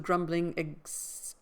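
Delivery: ordinary delivery, nothing marked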